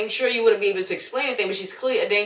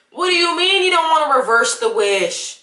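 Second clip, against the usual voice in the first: outraged; 8 semitones higher, 5 dB louder than usual. Real, not acted. Outraged at the movie character.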